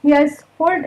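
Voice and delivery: normal talking voice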